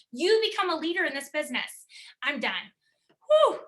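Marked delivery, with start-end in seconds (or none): 3.3-3.6 relieved whew. Real, not acted. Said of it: "I know you were sweating".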